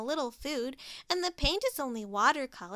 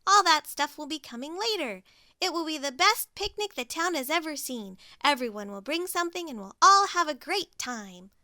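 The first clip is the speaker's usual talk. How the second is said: higher and louder, upbeat; cheery Pig; 4 semitones higher, 6 dB louder than usual. Acted. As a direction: reassure brightly. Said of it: "smiled Pig".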